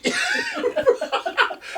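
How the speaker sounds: shrieking laugh; big high laugh; high burst of laughter. Real, not acted. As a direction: crack up high. Loud laugh.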